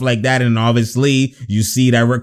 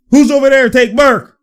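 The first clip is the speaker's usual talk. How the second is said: loud, high call-out; urgent shout; 9 semitones higher, 6 dB louder than usual. Real, not acted. Calling out a matchup on court, loud high shout. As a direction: yell it high like a courtside order.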